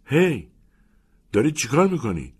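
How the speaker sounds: high, loud startled cry; alarmed shout. A narrator's reading. Locked-in boy shouting at the commander, alarmed. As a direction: call out in sudden alarm.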